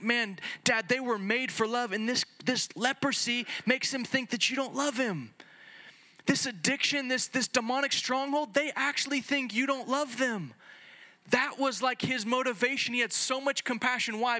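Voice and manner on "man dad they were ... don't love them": high, rising, urgent appeal to Dad; Jesus pleading with his father; impassioned plea. Retold or imitated. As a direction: plead for the suffering with fire. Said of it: "He was like".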